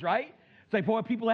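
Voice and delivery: usual speaking voice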